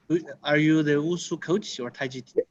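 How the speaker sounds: ordinary delivery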